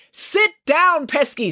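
high, forceful order; snapped. A narrator's reading. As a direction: snap it at an unruly child. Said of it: "snapped Mister Lang".

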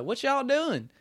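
mock Southern accent; Southern drawl. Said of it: "they were just like".

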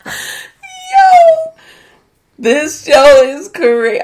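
very high, loud cry of shock; shocked outburst. Real, not acted. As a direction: yell it in stunned disbelief. Reacting to the show's absurdity.

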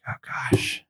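breathy under-the-breath oh gosh; mock dread, whispered to yourself; whispered dismay. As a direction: whisper it like you're dreading the price. Dread as prices climb.

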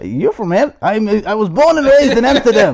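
high, hammed-up Dutch accent; proud mock Amsterdam native, Dutch accent. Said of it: "do not question my accent".

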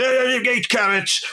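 a hint of the bored trader; mocking stallholder. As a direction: mimic a lazy market trader mumbling. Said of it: "he's clearly just going".

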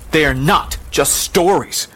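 slow, high-pitched insistence; mother's protest. Acted. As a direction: protest firmly, rising on the warning. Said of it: "the mother protested".

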